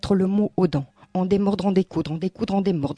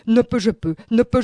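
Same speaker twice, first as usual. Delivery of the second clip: ordinary talk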